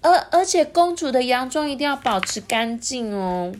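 mildly high reminder; a hint of a fussy queen. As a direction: remind about the dress. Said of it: "皇后就说".

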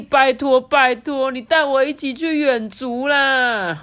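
high, slow whiny begging; childish pleading. Acted. Father begging his son for the outing, high childish whine.

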